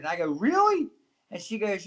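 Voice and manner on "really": high, loud disbelieving question; surprised really. Retold or imitated. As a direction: shoot it up in disbelief. Surprised reply to the mother.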